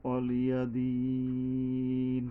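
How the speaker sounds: slow melodic tilawat with long drawn vowels; measured sung Quran reading; Quran recitation. Acted. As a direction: chant each verse slowly and reverently. Quran recitation, Surah Al-Kafirun.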